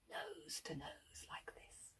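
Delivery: slow hushed whisper. A narrator's reading. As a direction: lean in and breathe it. Storyteller whispers suspense.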